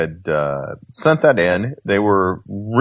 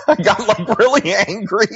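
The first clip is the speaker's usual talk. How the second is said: real laugh running through the words, pitched up high; 13 semitones higher than usual. Real, not acted. Guest laughing at his past anger while telling host. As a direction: laugh it out at yourself.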